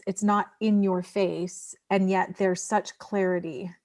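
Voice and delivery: ordinary talk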